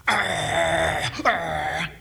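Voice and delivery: low growling dog noise. Retold or imitated. Pastor imitating the dog guarding her chew bone, growling low. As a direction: growl like a possessive little dog. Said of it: "she's going".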